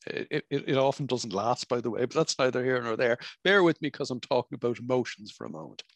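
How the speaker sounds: ordinary talk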